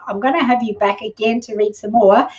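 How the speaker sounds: usual speaking voice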